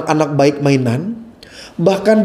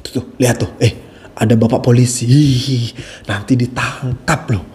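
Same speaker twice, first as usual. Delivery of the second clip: hushing parent; 8 semitones lower than usual. Acted. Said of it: "dengan bilang begini".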